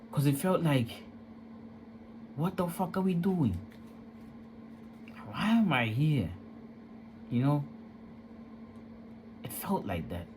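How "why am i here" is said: usual speaking voice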